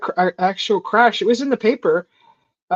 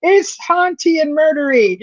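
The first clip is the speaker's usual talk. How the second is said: creeped out; 8 semitones higher than usual. Retold or imitated. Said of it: "And I'm like".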